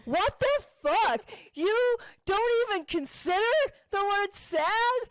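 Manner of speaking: high, slow drawn-out disbelief; stunned disbelief. Retold or imitated. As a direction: stretch it out, aghast. Retelling her incredulous reaction.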